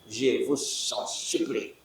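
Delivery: soft, slow mock plea; mock pleading. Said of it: "I beg you". (supplication feinte, douce et lente)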